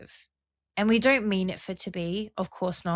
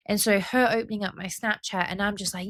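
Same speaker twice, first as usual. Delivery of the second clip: usual speaking voice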